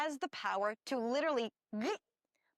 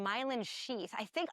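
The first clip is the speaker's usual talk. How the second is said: ordinary delivery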